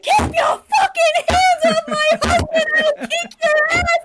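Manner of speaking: top-of-the-lungs high shriek; furious screaming. Retold or imitated. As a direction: scream a threat in full retold fury. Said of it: "I was screaming at the top of my lungs".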